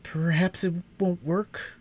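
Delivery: a lightly voiced boy, slow and downcast; weak, discouraged. A narrator's reading. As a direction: let the hope drain out, slowly. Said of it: "he muttered, in a voice that sounded weak and discouraged".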